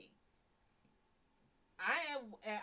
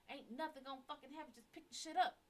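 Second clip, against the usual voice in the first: exasperated; 4 semitones higher, 3 dB quieter, faster than usual. Retold or imitated. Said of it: "I was so pissed off".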